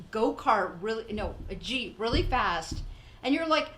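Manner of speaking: ordinary delivery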